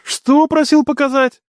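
high, drawn-out incredulous demand; outraged roar. A narrator's reading. Brother questions sister about suitor, outraged. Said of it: "взревел Дияр".

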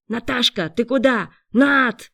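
loud, high alarmed calling; frightened shout. A narrator's reading. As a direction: call after someone running off, scared. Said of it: "испуганно закричал парень".

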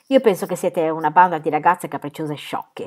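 ordinary delivery, nothing marked

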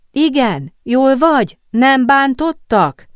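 high, loud worried questions; anxious calling. A narrator's reading. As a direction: call urgently to your friend in the next cell. Worried friends call between cells.

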